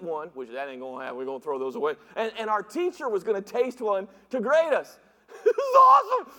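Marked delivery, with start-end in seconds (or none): 5.4-6.3 loud high laughing shout; gleeful shout. Real, not acted. Preacher recalling the prank, gleeful laughing shout. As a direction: burst out with glee. Gleeful about fooling teacher.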